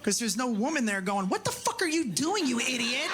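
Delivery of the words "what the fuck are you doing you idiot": a light mock of a nagging woman's voice, higher. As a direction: playfully scold him like an exasperated partner. Wife scolding a single man.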